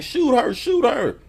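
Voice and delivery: high, urgent command; high hammed-up order to the gunman; mock order. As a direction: bark the order to shoot. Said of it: "ordering the dude".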